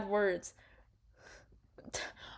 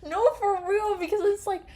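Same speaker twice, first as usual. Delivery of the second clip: high, loud incredulous laughter; laughing disbelief; 13 semitones higher, 5 dB louder than usual. Real, not acted. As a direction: crack up in disbelief.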